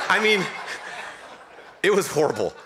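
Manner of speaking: said through real laughter; laughing. Real, not acted. Pastor recalling wife's collapse to the congregation, laughing. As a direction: laugh while calling it horrible. Laughing at his own story.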